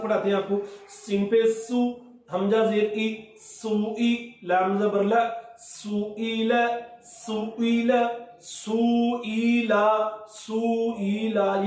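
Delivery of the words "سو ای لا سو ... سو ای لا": سو ای لا drawn out slowly, vowels held long; wrongly stretched. Shown as a demonstration. Teacher demonstrates the wrong stretched reading to the child. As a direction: drag each syllable of سو ای لا. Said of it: "یہ غلط ہو جاتا ہے".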